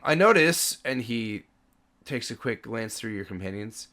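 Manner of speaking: ordinary talk, nothing marked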